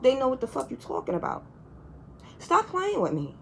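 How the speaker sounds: normal talking voice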